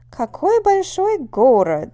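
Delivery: loud, high, slow "Какой большой город", held up until "город"; surprised exclamation. Shown as a demonstration. Small-town visitor amazed at how big Moscow is, surprised exclamation. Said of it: "we might be surprised at how big it is".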